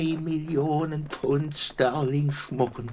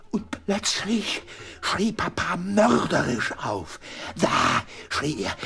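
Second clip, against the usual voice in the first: horrified retelling; 7 semitones higher than usual. Acted. Retelling father's dying scream.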